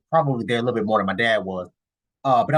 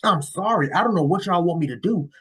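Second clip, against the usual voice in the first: ordinary talk, nothing marked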